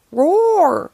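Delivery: loud, high Yeti roar; hollered roar. A narrator's reading. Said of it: "hollered Yeti".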